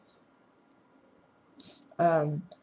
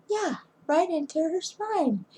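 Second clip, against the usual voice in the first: higher, breezy doctor voice; casual anesthesiologist; 10 semitones higher than usual. Retold or imitated. Anesthesiologist casually telling the husband about the spine needle. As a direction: toss it off like it's nothing.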